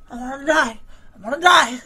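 high-pitched whine of despair. Real, not acted. Player despairing after a fall, whining high.

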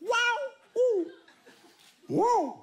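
ecstatic whooping. Acted. Engineer thrilled his caravan moves, whooping. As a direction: whoop like a kid on a ride.

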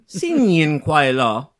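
slow, drawn-out attempt at Mandarin; bad Chinese accent. Shown as a demonstration. Cohost wishes host Happy New Year, bad Chinese accent. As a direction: offer the Chinese greeting slowly in an amateur accent. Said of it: "sounds like I have a bad accent".

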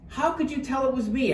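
high, quick question; surprised question. A narrator's reading. Turkey caught as a candy cane, high quick question. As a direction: pitch it up and ask in a hurry. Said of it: "asked Turkey".

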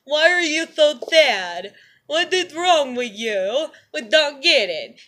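slow, whiny mock voice of clueless people; mocking clueless friends. Mocking people who don't get her pain, slow whiny voice. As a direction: drag it out like a dense onlooker. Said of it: "y'all are still walking around like".

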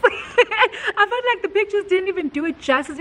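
high, giggly delight; laughing. Real, not acted. Vlogger telling viewers about the first viewing, laughing.

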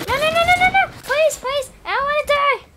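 high shrieking no no no; hammed-up panic. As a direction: wail it like a horror victim. Dramatic begging in game.

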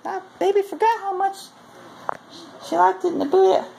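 very high falsetto imitation of a woman; mock female partner. Man retelling what she'll tell him, mock falsetto. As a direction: pitch it way up into put-on falsetto. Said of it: "And then she'll tell me".

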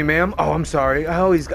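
normal talking voice

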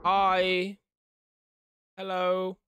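ordinary delivery

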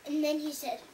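ordinary delivery, nothing marked

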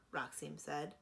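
ordinary delivery

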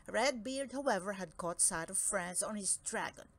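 usual speaking voice

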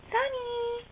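high, drawn-out singsong call; calling a pet. Real, not acted. Owner calls Sunny the pet, high singsong call. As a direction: call the pet's name up high.

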